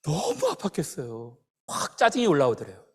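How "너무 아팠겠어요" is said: hushed gasp, then astonished question; doctor's amazement. Retold or imitated. Doctor asking how the boy endured it, hushed astonishment. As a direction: breathe in shock, imitating a stunned doctor. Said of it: "선생님이 그러더래요".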